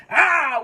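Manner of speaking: sudden loud, high "ah"; mock scream punctuating the blow; imitated stab cry. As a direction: act out the stab with a yell. Acts out the stabbing.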